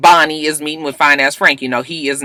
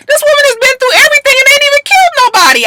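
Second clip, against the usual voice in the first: much higher, louder and fast; indignant outburst; 5 dB louder, faster than usual. Real, not acted. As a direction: rant in disbelief at Connor. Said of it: "I swear I can't stand Connor".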